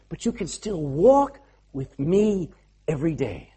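low, softened, tender assurance; gentle reassurance. Acted. Jesus comforting disciples as he leaves, gentle reassurance.

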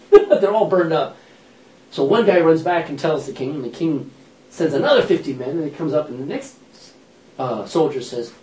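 ordinary talk, nothing marked

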